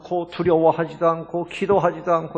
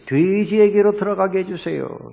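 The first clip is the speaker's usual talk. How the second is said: imitated pleading; 5 semitones higher, 5 dB louder than usual. Demons repeating their request to Jesus, imitated pleading. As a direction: beg with stretched words, in imitation. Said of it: "이 사람이 간구합니다".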